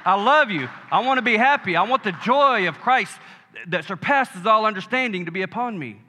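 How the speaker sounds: joyful prayer called out loud; joyful declaration. Shown as a demonstration. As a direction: lead the church in a happy shout.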